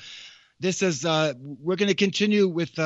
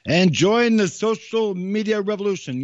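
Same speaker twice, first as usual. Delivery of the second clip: higher, louder hammed-up announcer echo; playful send-up of a radio promo voice, pitched up and pushed louder; mock ad announcer; 5 semitones higher, 5 dB louder than usual. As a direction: parrot the ad's big pitch line, tongue in cheek. Echoing the ad announcer mockingly.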